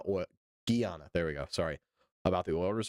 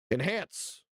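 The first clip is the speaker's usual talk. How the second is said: calm first enhance order; mock command. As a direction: say enhance like a movie detective. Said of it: "I said enhance".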